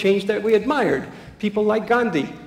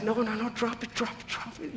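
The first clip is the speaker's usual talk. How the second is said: hushed self-urging; 5 semitones higher, 10 dB quieter than usual. Retold or imitated. Buddy telling himself to let go.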